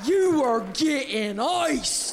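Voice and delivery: high, loud, drawn-out holler; yelled command. Acted. Older woman ordering comedian to drink, yelling. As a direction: order them down, stretching every word. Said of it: "Linda yells".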